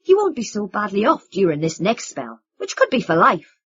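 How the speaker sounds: bitter sarcasm. Acted. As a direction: lean hard on the irony. Vin bitter mockery.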